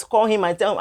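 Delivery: ordinary talk, nothing marked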